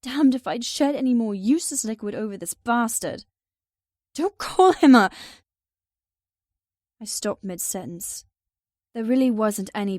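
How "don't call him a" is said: loud, high cry of protest; angry outburst. Acted. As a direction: burst out, then cut off. Heroine snaps in protest.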